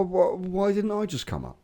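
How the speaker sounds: ordinary talk, nothing marked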